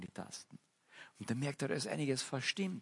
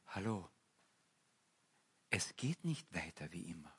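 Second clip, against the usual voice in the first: softer, low call from God; God speaking gently; 3 semitones lower, 5 dB quieter than usual. Acted. God gently calls people to come to Him, soft and low. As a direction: invite them in quietly and earnestly.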